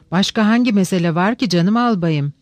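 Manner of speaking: ordinary delivery, nothing marked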